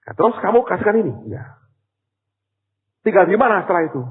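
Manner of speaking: high, loud astonished asking; incredulous questions. Retold or imitated. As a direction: press him in disbelief. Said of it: "Saya bilang". (bertanya heran dengan suara tinggi)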